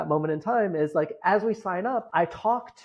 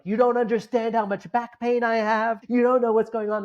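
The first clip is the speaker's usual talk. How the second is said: lightly voiced whiny inner voice, higher; a touch of petulant complaint, pitched up; the mind's voice whining, mildly higher; 6 semitones higher than usual. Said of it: "the voice in my head is like".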